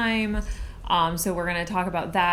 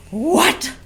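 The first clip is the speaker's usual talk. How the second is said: high, loud squeal of disbelief; retold surprise; 9 semitones higher, 6 dB louder than usual. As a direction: shriek it like you can't believe your eyes. Arriving to find Pappy poured.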